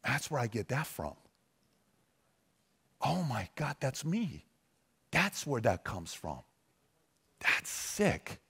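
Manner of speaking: put-on imagine a grandson quietly awed by old footage; emotional grandson. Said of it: "getting emotional over a hundred hours to say".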